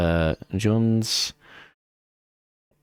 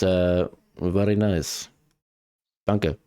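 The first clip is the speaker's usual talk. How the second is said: put-on German accent; 4 semitones lower, slower than usual. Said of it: "German accent".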